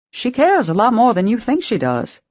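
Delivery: a lightly voiced grandmother, knowing; older Southern woman. A narrator's reading. Virginia voiced, higher.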